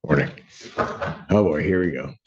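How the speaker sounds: normal talking voice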